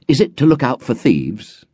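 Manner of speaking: put-on a town boy asking eagerly; curious boy. A narrator's reading. Narrator as a boy asking Runtu, curious boy. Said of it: "I asked".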